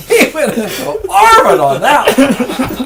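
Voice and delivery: can't stop laughing while telling it. Real, not acted. Laughing recalling the prank.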